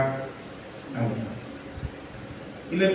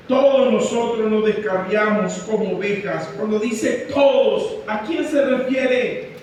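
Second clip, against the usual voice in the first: fervent preaching; 4 semitones higher than usual. Acted. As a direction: pound the verses home to the congregation. Preaches at full force.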